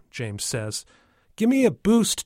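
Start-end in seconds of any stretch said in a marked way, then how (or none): 1.3-2.2 put-on high, loud child's demand; little boy. Tommy asks James for a lift, in a little boy's voice. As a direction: pipe up like a small kid.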